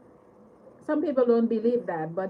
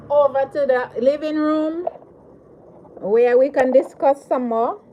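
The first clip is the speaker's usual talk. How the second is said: louder, announcing; upbeat louder announcement; 8 dB louder than usual. Real, not acted. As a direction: call it out to the viewers. Announces move to living room.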